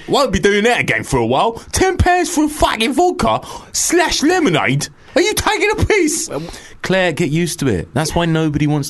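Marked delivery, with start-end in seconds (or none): 0.1-6.3 very high, hammed-up outraged voice; over-the-top high griping character; unconvincing female emailer. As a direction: squawk the complaint in mock outrage. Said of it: "wasn't a very effeminate voice".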